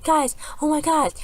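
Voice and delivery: very high, unforced panicked squeal; mock screaming. Amy on the ship to friends, high mock scream. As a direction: imitate her shrieking for help in a high voice. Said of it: "And then screaming and stuff".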